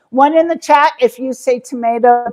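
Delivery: tomato said as tuh-MAY-toe; tomato with long a. Shown as a demonstration. Repeating the chat poll, tomato as tuh-MAY-toe. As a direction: say tomato with the ay vowel.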